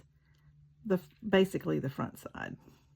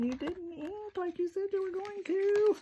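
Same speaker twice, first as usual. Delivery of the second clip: much higher, put-on complaining voice; mock hollering viewers; 12 semitones higher than usual. Crafter imagines viewers hollering at her, high mock holler. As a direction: imitate viewers hollering, pitch way up. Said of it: "Y'all are probably hollering at me going".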